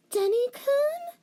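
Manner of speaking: put-on high girlish call, slow and rising. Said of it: "she called out".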